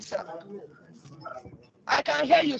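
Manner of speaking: ordinary talk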